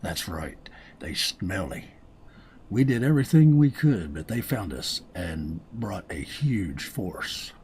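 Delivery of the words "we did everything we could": voiced as a desperate survivor, loud and higher. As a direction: survivor telling the player, loudly pressed. Game character story voiced.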